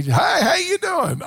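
high, drawn-out imitated hello; imitating a delighted, high-voiced greeting; eager greeting. Retells Marvin greeting him.

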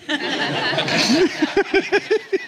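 a light laugh at his own joke; real laugh. Real, not acted. Preacher laughing at the disciples' excuse, amused laugh.

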